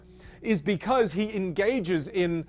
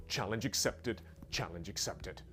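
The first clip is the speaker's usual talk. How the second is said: much softer, dropping away to himself; quiet aside; 7 semitones lower, 13 dB quieter than usual. Real, not acted. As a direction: mutter it as a private aside. Muttered reply to himself.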